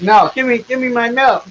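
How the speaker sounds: high, whiny begging; whiny milkman. Acted. Pathetic milkman crawling after his milk, high and whiny.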